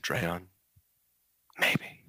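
mildly hushed, confiding delivery; understated, mock-ominous aside. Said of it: "I lowered my voice".

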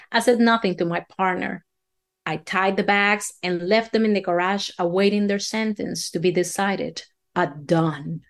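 usual speaking voice

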